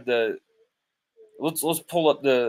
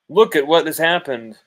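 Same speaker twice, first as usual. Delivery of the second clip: loud repeat; 4 semitones higher, 6 dB louder than usual. Real, not acted. Host repeating to critics, loud and insistent. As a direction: still raised, a touch less hard, driving it in.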